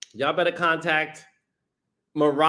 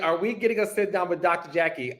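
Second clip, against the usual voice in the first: normal talking voice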